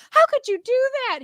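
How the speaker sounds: high, indignant crowd voice; outraged audience. Retold or imitated. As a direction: scold like a shocked crowd. Audience turns on comedian.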